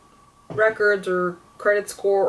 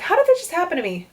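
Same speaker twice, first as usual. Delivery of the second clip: high-pitched, fast incredulous question; acted-out bewildered outburst; exasperated disbelief; 9 semitones higher, much faster than usual. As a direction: retell it like a baffled complaint. Retelling exasperated call to fiance.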